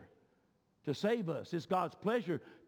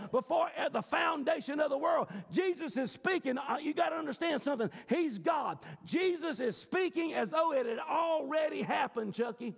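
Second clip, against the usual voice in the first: full-force preaching; 8 semitones higher than usual. Real, not acted. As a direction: preach it hard, hitting the key words.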